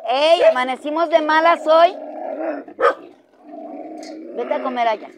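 loud, high challenge to a grumpy dog; scolding call-out. Real, not acted.

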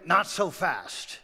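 ordinary delivery, nothing marked

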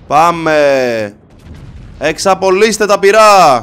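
loud, slow call to fire; rally the guns like a captain; battle command. Acted. Ordering his crew to open fire, loud and slow.

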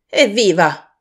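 loud, hearty hurrah; merry exclamation; jovial cheer. A narrator's reading. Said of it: "esclamò il vecchio Fezziwig".

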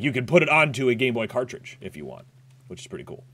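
normal talking voice

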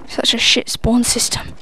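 ordinary talk

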